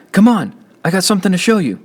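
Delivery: put-on high, eager older brother; excited, pulling him along; eager call. A narrator's reading. Big brother eagerly calling Kenny to come see.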